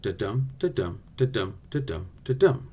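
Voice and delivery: "Da-dum" with light da and strong DUM, evenly repeated; steady heartbeat "da-DUM"; iambic beat. Shown as a demonstration. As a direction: tap out five "da-dum" pairs, accent on each dum. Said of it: "the meter, goes like this".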